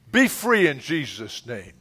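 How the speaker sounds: ordinary delivery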